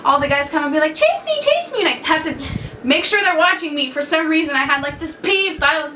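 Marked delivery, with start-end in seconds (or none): 0.9-1.8 high, quick mock kid chant; kids' playground taunt. Boys calling to play tag at school, high playful taunt. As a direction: imitate kids begging to be chased.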